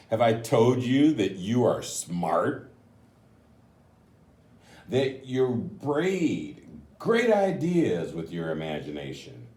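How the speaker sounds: gentle, slowed storybook reading; reading to children. A narrator's reading. Reads the picture book aloud.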